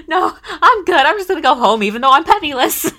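giggly imitation of a flustered girl; laughing quote. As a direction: squeak it out laughing, fast at the end. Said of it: "She's like".

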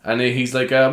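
ordinary talk, nothing marked

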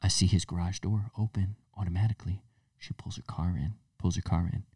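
whispered, secretive retelling; hushed spy whisper. Retold or imitated. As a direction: whisper like you're still hiding. Retelling spying on neighbor.

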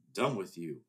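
ordinary talk

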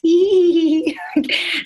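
high, drawn-out giddy "eee" giggle; childlike giggle. Retold or imitated. Guest mimics herself giggling at a beautiful celebrity, childlike giggle. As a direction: imitate yourself giggling like a starstruck kid. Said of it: "giggling the whole time, like a child".

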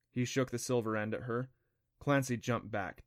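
ordinary delivery, nothing marked

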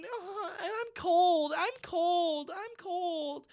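high, drawn-out whine; whiny child. Retold or imitated. Little girl wanting the next thing, whining. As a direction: mimic a spoiled little kid complaining.

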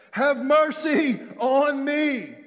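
high, loud, drawn-out plea; shouting. Acted. Blind beggar begging Jesus for mercy, shouting. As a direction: cry out slowly at full voice. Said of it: "he began to shout".

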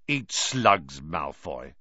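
understated angry retort; mildly cross, dropping on the name. A narrator's reading. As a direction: throw the insult with a touch of anger. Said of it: "said Ron angrily".

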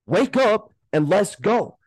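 sudden high, loud call to snap out of it, slowing down on the last words; wake-up call. Real, not acted. Podcast coach yelling at listeners who are giving up, loud and high. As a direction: shout them awake like a coach.

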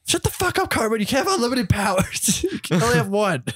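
high, squeaky retold South Park kid yelling; mock kid voice. Friends scolding Cartman in a squeaky kid voice. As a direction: squeak it out like an angry cartoon kid.

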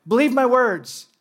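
"Believe my words" high and drawn out; emphatic urging. Acted. Acts out Jesus urging the disciples.

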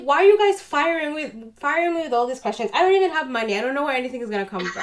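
rapid-fire retold exasperation; exasperated retort. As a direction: fire back, overwhelmed by questions. Retold retort to pestering questions.

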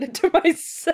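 giggling through the words; half-laughing mock excuse. Mock confession about hurting yourself.

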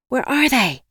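frustrated yell. A narrator's reading. Woman searching her bag for keys, yelling. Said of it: "I yelled".

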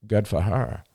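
plain reading voice

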